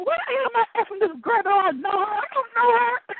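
high-pitched, incredulous self-questioning; baffled protest. Retold or imitated. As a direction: protest to yourself in a high, bewildered voice. Retells her baffled inner protest.

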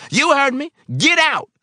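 very high, loud order; furious scream. A narrator's reading. Old man to confused helper, screaming to get out. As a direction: scream him out of the room.

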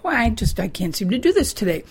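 usual speaking voice

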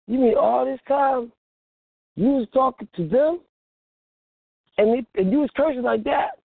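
questioning in rising disbelief; incredulous. Acted. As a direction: act out a dawning, incredulous realization. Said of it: "now you looking like".

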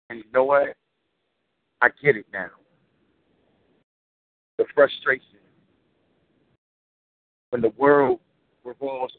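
rhythmic, weighty poem recital; spoken word poetry. Acted. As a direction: deliver it like a slam poem.

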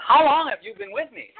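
high, lively and emphatic; read with oomph. Shown as a demonstration. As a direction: put real feeling into the question. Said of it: "give it some oomph".

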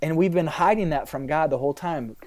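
normal talking voice

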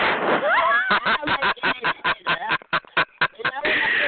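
long high cackle in pulses; mocking laugh. Real, not acted. Streamer dismissing the rival, long staccato cackle. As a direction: cackle on and on at them.

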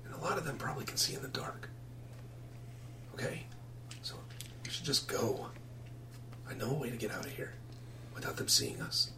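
hushed, near-whispered plea; soft secretive boy; furtive runaway boy. Acted. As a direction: keep it very quiet, barely voiced.

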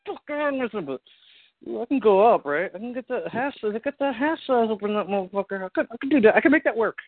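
high, bargaining inner voice; high mock self-talk. Imitating frustrated shoe buyer.

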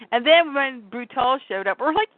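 ordinary delivery

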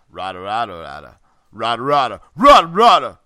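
a hint of a grumbling cartoon giant; Schnitzel voice. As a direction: build the babble from low to loud. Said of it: "I mastered his voice, Schnitzel's".

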